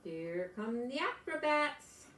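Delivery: usual speaking voice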